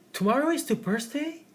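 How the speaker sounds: high, surprised rise on birthday; surprised rising question. Shown as a demonstration. Teacher demoing surprise to students, high rising. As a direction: pitch jumps up in surprise.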